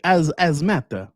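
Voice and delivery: slow mock-French "As, as matter"; French accent, silent H. Shown as a demonstration. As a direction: stretch "As, as matter" with the H dropped, French style. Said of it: "French don't pronounce their H's".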